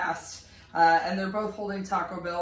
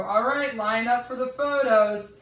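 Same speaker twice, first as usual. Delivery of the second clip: loud, higher call to the crowd; 4 semitones higher, 6 dB louder than usual. Retold or imitated. Staff member calling the crowd to line up, loud. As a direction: bellow it to the whole line. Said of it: "we hear this person go".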